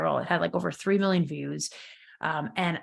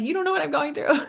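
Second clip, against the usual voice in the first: higher, voice cracking with tears; tearful outburst; 8 semitones higher than usual. Retold or imitated. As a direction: let the tears break through the words. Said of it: "I'm like".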